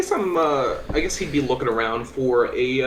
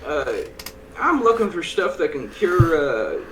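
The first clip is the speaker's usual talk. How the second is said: polite adventurer; 6 semitones higher than usual. Acted. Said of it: "I step in and go".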